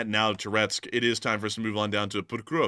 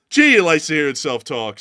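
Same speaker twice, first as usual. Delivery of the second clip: loud mocking quip; mock heckle, loud; mock heckler; 4 semitones higher, 10 dB louder than usual. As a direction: bark it like a heckler in the crowd. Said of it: "says someone".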